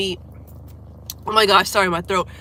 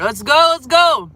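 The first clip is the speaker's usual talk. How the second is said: higher, louder and slow; hyped cheer; 7 semitones higher, 9 dB louder, slower than usual. Real, not acted. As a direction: cheer the fans on. Hyped about the concert.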